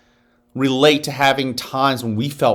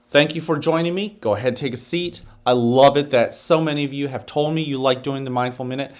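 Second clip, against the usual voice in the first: ordinary talk, nothing marked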